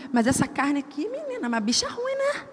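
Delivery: a petty inner grumble, exaggerated; mock grumpy flesh. Voz da carne irritada. (a carne reclamando, voz bem aguda e mais baixa)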